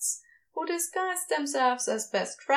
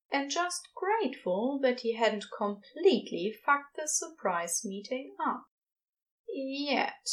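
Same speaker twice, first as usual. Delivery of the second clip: usual speaking voice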